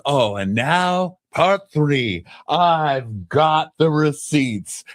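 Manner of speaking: high, slow smug reveal; mocking Shamia. Shamia revealing her evidence, smug mock voice. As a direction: drawl the big reveal in a smug squeak. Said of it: "Shamia's like".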